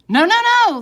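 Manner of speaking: loud high panicked no; shout. A narrator's reading. Family stopping Oliver from brushing, loud high panicked cry. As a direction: stop him with a cry. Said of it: "the three shouted".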